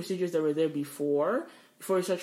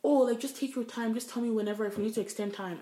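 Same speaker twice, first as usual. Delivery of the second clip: high-pitched mock-nice reassurance; oh-so-nice, higher and easygoing; imitating sweet niceness; 6 semitones higher than usual. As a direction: put on her accommodating voice, pitched up. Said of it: "she sounds so nice".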